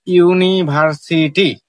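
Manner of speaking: slowly broken into parts, ইউ-নি-ভার-সি-টি; teacher's slow syllable-split demo of ইউনিভার্সিটি. Shown as a demonstration. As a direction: draw out ইউনিভার্সিটি one syllable at a time. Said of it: "ভাগ ভাগ হয়ে উচ্চারণ".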